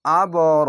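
আবরর with a bouncing qalqala push at the end; qalqala bounce. Shown as a demonstration. Teacher showing students the qalqala echo in আবরর. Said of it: "কলকলা ধাক্কা মেরে নিচে sound আসবে".

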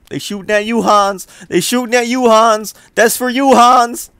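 high, loud mocking taunt; man down shout. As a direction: yell it up high, jeering. Taunting enemy soldiers during firefight.

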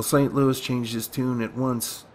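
plain reading voice

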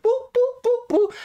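string of high little boops; tiny high beeping noises by mouth; high-pitched boops. Acted. Imitates noises after saying blueprint.

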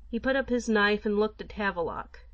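usual speaking voice

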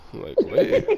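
high, loud mock disbelief, way up; a sudden squeak of shock at the claim; incredulous outburst. Friend retelling his own disbelief at a teleport claim, high loud incredulous squeak.

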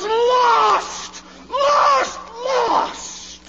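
rising cry. Real, not acted. Pleading over lost souls.

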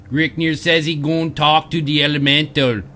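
put-on half-hearted Jamaican and Arnold troll accent; a hint of Jamaican-Arnold troll accent; troll accent mixing Jamaican and Arnold. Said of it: "a mix of, like, Jamaican and Arnold".

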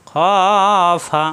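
long rounded "খ", short "ফা"; heavy kha madd. Shown as a demonstration. Teacher models joined word, drawn-out heavy "খ" then "ফা". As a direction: stretch "খ" with an aw vowel, then "ফা". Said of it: "মোটা হরফ".

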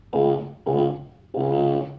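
very low throaty "او, او, او" pulses from the larynx; deep throat bass. Shown as a demonstration. Beatbox teacher showing viewers throat bass: deep, punchy "او" hits.

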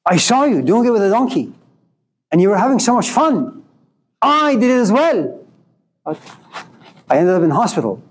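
a touch of angry reproach to the neighbor; angry complaint. Retold or imitated.

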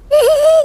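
high-pitched, loud tittering laugh; high giggle. Real, not acted. Gamer laughing at the baby in the game, high giggle. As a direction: let out a quick high giggle.

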